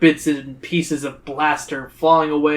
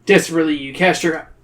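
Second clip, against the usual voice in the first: plain reading voice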